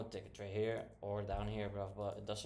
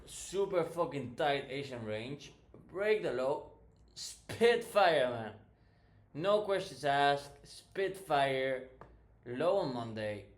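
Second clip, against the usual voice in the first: hyped up; 3 semitones higher, 8 dB louder, slower than usual. Real, not acted. Hyped recap of a winning trade.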